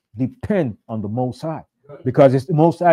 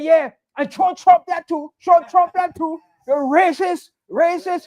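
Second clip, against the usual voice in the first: much higher scornful jeer; cursing them out; 10 semitones higher than usual. Retold or imitated. Woman mocking agents about Trump, high scornful jeer. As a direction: spit the insult out high and scornful. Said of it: "She, she was cursing them".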